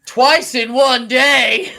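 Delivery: high, loud, drawn-out mock scream; imitated scream. Retelling the burned patient's outburst, imitated scream. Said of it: "And he screams".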